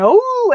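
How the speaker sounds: high howling "Oh"; dog howl. Retold or imitated. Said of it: "She howls".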